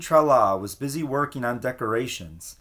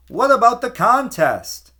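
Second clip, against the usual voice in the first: high, loud protest from another townsperson; called out; 10 semitones higher, 8 dB louder than usual. A narrator's reading. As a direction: call it out across the square. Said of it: "called another voice".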